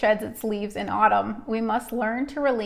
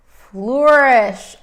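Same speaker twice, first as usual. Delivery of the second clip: normal talking voice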